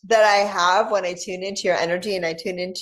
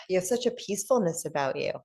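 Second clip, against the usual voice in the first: normal talking voice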